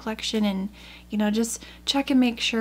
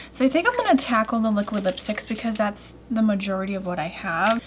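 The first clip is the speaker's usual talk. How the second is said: usual speaking voice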